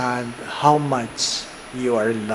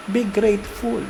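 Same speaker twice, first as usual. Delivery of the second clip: "Be grateful" lifted higher and a little softer; emphatic higher repeat; 6 semitones higher, 4 dB quieter than usual. Shown as a demonstration. Priest urging his congregation with a higher "Be grateful". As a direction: say "Be grateful" once more, pitched up, pressing it home. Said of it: "Let me repeat that".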